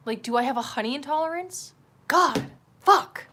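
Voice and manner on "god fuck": loud, higher burst of swearing; loud, fed-up outburst; frustrated cursing. Real, not acted. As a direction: spit out two exasperated curses. Cursing over honey intolerance.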